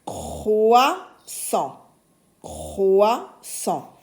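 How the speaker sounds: croissant split in two, "oua" held; sounded-out word. Shown as a demonstration. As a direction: pause between the syllables of "Croissant". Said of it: "j'entends le son oua". (croissant découpé en deux syllabes)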